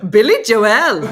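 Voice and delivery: loud mock-Irish outburst; exaggerated, loud Irish accent imitation; imitating Dean's accent. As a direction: blurt it out in a parody Irish accent. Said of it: "here's Dean".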